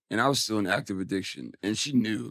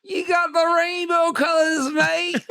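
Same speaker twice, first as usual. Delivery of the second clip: high, loud Aussie voice with a teary crack, slower; 6 dB louder, much slower than usual. Retold or imitated. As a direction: put on a big excited Australian accent, near tears. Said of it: "She was like".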